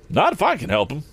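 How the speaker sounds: high, spirited driver; prompt retort. A narrator's reading. Eradicate takes Tom's side, prompt retort. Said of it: "answered Eradicate promptly".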